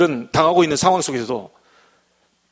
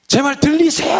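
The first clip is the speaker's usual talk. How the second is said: high, louder hammed-up yell right in the ear; mock shout; 10 semitones higher, 4 dB louder than usual. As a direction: bellow it up close as if the listener were deaf. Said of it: "대놓고 막".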